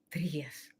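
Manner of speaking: breathy whisper, dropped right down. Real, not acted. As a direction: whisper it like a secret.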